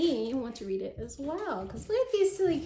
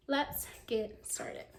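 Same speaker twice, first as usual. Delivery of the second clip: soft whispered aside; hushed let's begin; 7 dB quieter, much slower than usual. Real, not acted. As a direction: lean in and whisper it. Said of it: "whispered aside".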